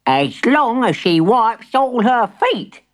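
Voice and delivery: put-on high, slow old-granny voice; storybook granny, drawn-out and high; fussy granny. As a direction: lay down a condition slowly, high. Plays Granny Knot's grudging condition.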